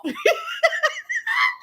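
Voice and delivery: very high shriek of laughter; high squealing laugh. Real, not acted. Laughing at the comments.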